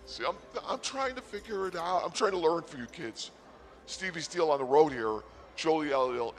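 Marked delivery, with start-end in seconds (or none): none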